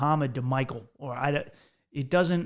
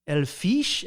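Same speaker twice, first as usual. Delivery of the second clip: mock Spanish accent on English; fake Spanish accent; 3 semitones higher, 3 dB louder than usual. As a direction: put on a bad Spanish accent. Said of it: "like I had a Spanish accent".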